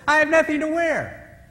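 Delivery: high woman's voice; high, a woman lamenting her clothes; woman speaking. Retold or imitated. Woman among ten women, high-voiced lament. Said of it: "she says".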